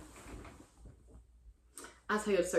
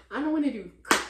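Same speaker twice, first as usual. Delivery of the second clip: loud, raised voice; loud complaint; 6 semitones higher, 9 dB louder, faster than usual. Real, not acted. First loud take of her dating rant. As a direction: shout it out, fed up.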